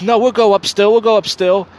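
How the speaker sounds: lightly voiced pleading man; Roger insisting. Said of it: "and Roger's like".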